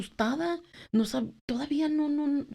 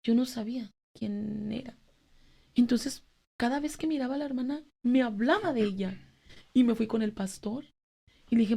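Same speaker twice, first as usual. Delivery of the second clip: normal talking voice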